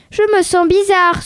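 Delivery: plain reading voice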